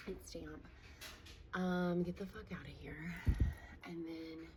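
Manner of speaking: usual speaking voice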